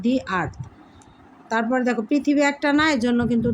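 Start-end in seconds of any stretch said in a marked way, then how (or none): 0.1-0.5 দি earth with an "ee" vowel in দি, falling lower; "the" as "dee". Shown as a demonstration. Teacher concluding the vowel rule, "dee" sound. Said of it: "এখানে দি উচ্চারণ হবে".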